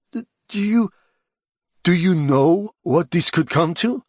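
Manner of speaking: high, stammering question; agitated stammer. A narrator's reading. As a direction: stumble into it, alarmed. Stammering, upset man asks.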